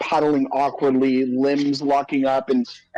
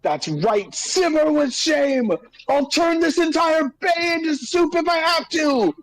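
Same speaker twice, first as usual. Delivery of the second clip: high-pitched raging threat; screaming at water; 13 semitones higher than usual. Acted. Fire caster vows to boil the bay, raging scream. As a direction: build into a high, stretched-out scream. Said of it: "I'm just screaming out the water".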